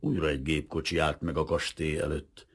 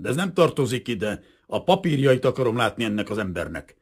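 brusque demand; 6 semitones higher, 6 dB louder than usual. A narrator's reading. Lieutenant keeps demanding.

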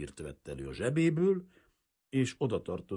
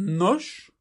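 raised, loud, rising one-word prompt; challenging question; 9 semitones higher, 7 dB louder than usual. A narrator's reading. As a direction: prompt them expectantly. Kazimír nonchalantly challenges the detectives. (kihívó, várakozó kérdés)

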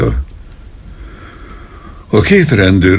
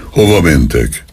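ordinary delivery

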